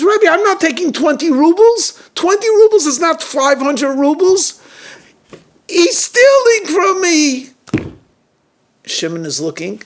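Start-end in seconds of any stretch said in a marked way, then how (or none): 0.0-7.5 high, loud outraged appeal; upset, distraught protest. A narrator's reading. Cheated guest protesting the twenty rubles, really upset. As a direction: wail the grievance at high pitch, drawing out the end. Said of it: "he's really upset".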